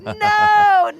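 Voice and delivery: high, loud, drawn-out "no" of protest; mock horrified cry. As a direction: wail it like a horrified audience, hammed-up. Imitates audience reacting to scary scene.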